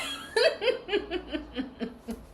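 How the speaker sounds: long, high trailing giggle; laughing. Real, not acted. Reader cracking up at offended ex impression, trailing laughter. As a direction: laugh high until it peters out.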